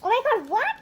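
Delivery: high-pitched, swooping disbelief; real stunned surprise; astonished. Real, not acted. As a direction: let "what" leap up in shock. Surprised by the card pulled.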